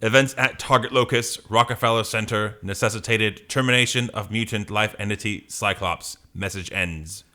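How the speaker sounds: monotone machine message; robot voice. Acted. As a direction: recite the report, dropping off at the end. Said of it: "Are you gonna do a robot voice?".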